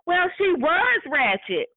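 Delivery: high, slow, offhand shrug of a line; dismissive coworker. Retold or imitated. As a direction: drag it out like it's obvious. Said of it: "And she was like".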